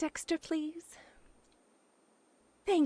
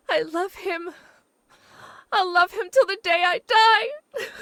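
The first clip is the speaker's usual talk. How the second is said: higher and louder, breaking into sobs; crying declaration; 6 semitones higher, 7 dB louder than usual. Acted. Jilted woman to her friend, crying out her love. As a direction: swear it through tears.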